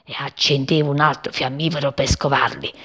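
plain reading voice

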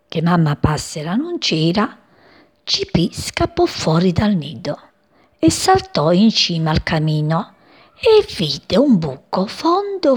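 ordinary delivery, nothing marked

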